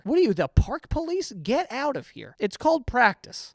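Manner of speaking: ordinary talk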